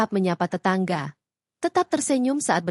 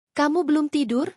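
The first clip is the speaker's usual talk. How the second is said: higher-pitched question with a touch of irritation; slightly annoyed; 7 semitones higher than usual. A narrator's reading. Husband comes home to his waiting wife, slightly annoyed. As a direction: ask it mildly put out, pitch lifted. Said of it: "dengan nada sedikit kesal".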